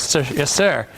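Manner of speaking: ordinary talk, nothing marked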